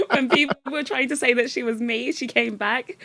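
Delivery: ordinary talk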